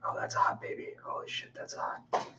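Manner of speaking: breathless whisper under his breath; hushed private whisper while working; whispering to himself. Real, not acted. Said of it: "I know I'm whispering to myself".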